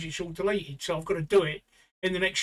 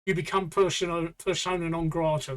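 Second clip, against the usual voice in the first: normal talking voice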